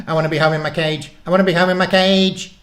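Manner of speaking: high, loud frantic wail; tiny creature in hysterical panic; panicked guinea pig. A narrator's reading.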